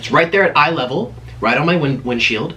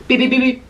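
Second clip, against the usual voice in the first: notification beeps; 9 semitones higher than usual. Retold or imitated. Imitating phone notification beeps.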